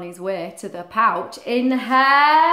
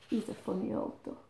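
plain reading voice

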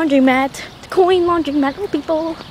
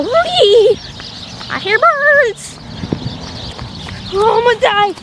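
gleeful squeal; 8 semitones higher, slower than usual. Real, not acted. Running across the street.

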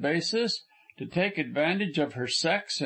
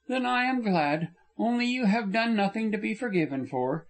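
a higher, earnest young woman's voice, only lightly put on; lightly voiced young woman; 6 semitones higher than usual. Frona insisting to Corliss she behaved badly, lightly raised young woman's voice. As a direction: lift the voice a little for the heroine, remorseful and insistent.

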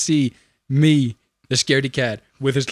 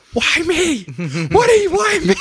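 shrieking much higher, half laughing; mock terrified scream; 5 dB louder than usual. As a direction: squeal it like a scared kid, cracking into laughter. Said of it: "I'm screaming".

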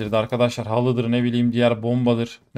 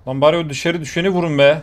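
higher, louder call to teammates; shouted order; 4 semitones higher, 6 dB louder than usual. Real, not acted. Gamer shouting at teammates to shoot the fallen enemy, loud order. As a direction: yell it at your squad.

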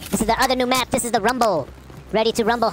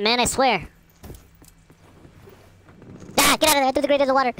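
normal talking voice

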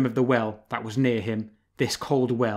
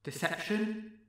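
strange distant voice; 6 semitones higher, 9 dB quieter than usual. Acted. Distant voice from the well.

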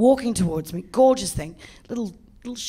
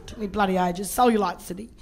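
usual speaking voice

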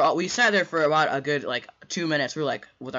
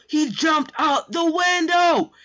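slow, loud, very high shriek; high-note scream; 8 dB louder, much slower than usual. Retold or imitated. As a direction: scream it stretched out in total shock. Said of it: "when I hit those high notes".

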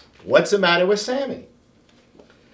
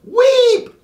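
loud, high squeal; guinea pig shriek; 7 dB louder than usual. A narrator's reading. Guinea pig interrupting the lesson, loud high shriek. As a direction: shriek at the top of his lungs. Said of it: "he shrieked at the top of his lungs".

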